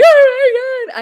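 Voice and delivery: squealed high pretend panic, laughing; hammed-up high panicked squeal; mock panic. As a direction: shriek it as a pretend freakout. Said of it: "I would just be screaming".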